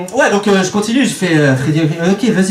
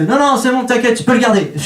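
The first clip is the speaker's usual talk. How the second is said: breezy businessman; 8 semitones higher than usual. Retold or imitated. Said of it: "il fait".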